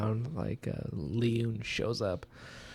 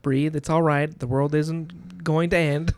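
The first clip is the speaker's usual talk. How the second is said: slow, loud, higher-pitched condescension; talking to a child; 5 semitones higher, 8 dB louder, slower than usual. Acted. Reader imitating Painter calming Yumi, talking down to her. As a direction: spell it out as if to a small child. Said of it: "As I talk like he's speaking to a child".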